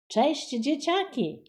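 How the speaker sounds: bright, high greeting; mock-cheery hello to the kids; cheerful call. A narrator's reading. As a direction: call out with a smile. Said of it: "zawołał uśmiechnięty pisarz".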